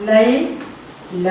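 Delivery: teacher drawing out ലൈല for pupils; drawn out slowly. Shown as a demonstration. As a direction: stretch ലൈല very slowly, syllable by syllable. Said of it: "ഇനി അടുത്തൊന്ന് നോക്കാം". (ലൈല വളരെ പതുക്കെ നീട്ടി)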